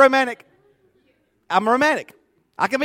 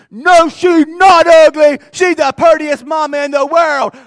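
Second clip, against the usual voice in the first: put-on high, loud indignant child yelling; child's shout; 8 semitones higher, 11 dB louder, slower than usual. Little boy shouting back at the daycare bully, outraged. Son defends mama, shouting.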